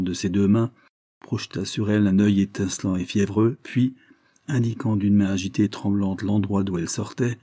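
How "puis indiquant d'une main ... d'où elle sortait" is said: usual speaking voice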